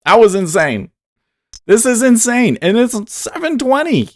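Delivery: loud, high burst of amazement; excited. Real, not acted. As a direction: blurt it out, thrilled. Reacting to a wild market move.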